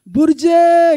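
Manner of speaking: slow, high, loud call across the forest; loud calling shout. Retold or imitated. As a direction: call out long and loud like into the woods. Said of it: "Itu teriak katanya".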